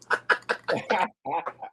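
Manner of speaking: short burst of chuckling; real, staccato chuckle; amused laugh. Real, not acted. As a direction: laugh at your own joke. Laughs at own mocked apology.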